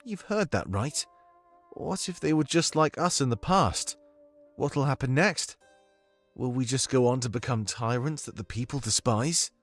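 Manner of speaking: put-on questioning British accent with lilting rises; Domik's comforting accent. Domik worries they will become tyrants, questioning British accent. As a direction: voice growing doubts in a British accent. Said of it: "I need your comforting accent right now".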